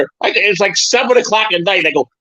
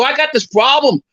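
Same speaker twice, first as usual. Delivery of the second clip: high, eager fake caller voice; a high put-on voice for a prank call; 7 semitones higher than usual.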